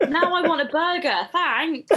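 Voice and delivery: loud, high, amused protest; laughing mock complaint. Real, not acted. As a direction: complain loudly through a real laugh. Mock complaint while laughing at joke.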